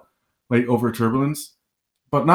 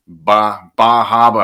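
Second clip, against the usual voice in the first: put-on Boston accent, dropped r; New England accent; 7 dB louder, slower than usual. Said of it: "I wanna hear your New England accent".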